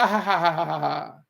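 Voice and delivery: long ha-ha laugh trailing downward; imitated laugh. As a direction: mimic a big laugh and let it run out. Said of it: "I like that".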